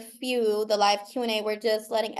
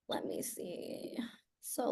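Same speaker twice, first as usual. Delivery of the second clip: whisper; 15 dB quieter, slower than usual. Real, not acted. Searching files, talking to herself.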